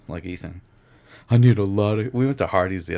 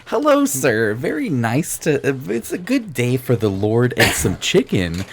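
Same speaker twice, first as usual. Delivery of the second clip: drive-thru worker voice; 5 semitones higher, 5 dB louder, slower than usual. Acted.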